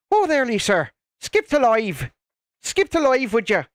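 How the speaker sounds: lightly put-on Irish lilt, calling Lisa; Irish accent. Streamer calling to Lisa in a light Irish accent. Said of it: "I don't think I did a terrible Irish accent there".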